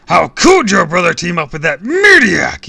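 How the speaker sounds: high, furious full-volume shout; screaming. A narrator's reading. Villain outraged at the kid's brother, screaming. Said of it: "he screamed".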